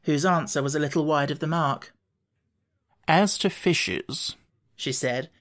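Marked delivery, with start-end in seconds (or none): 3.1-4.4 very slow, weighty delivery; slow and solemn. A narrator's reading. Red Queen to Alice's ear, slow and solemn. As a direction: draw the words out solemnly. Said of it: "very slowly and solemnly".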